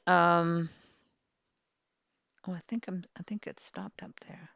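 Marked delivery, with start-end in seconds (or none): 2.5-4.5 whisper. Real, not acted. Aside while gaming.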